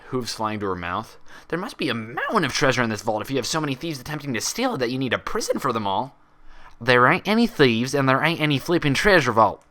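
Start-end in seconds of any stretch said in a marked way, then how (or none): 1.5-6.2 lightly raised bubbly pony voice; Pinkie, eager and wide-eyed. Acted. Pinkie gasping to Applejack about a treasure vault, eager. 6.8-9.6 loud, fed-up country snap; exasperated outburst. Acted. Applejack losing patience with Pinky, exasperated and loud. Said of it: "Applejack's exasperated voice was loud".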